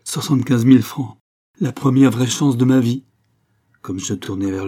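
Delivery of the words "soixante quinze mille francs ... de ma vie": exultant. Acted. Old man exults over bounty.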